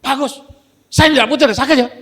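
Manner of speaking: loud, high snapping retort; hard snap. Retold or imitated. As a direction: bark it back hard, though not truly angry. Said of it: "gertak dengan keras".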